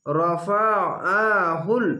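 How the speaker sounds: very slow, drawn-out Arabic reading of রফাআহুল; Quranic recitation demo. Shown as a demonstration. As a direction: recite রফাআহুল slowly with a heavy throat-pressed আইন. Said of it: "আইনটিকে মোটা করে গলা চিপে পড়বেন". (গলা চিপে ধীরে তিলাওয়াত)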